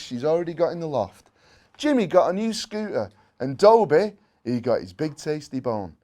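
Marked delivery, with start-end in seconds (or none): none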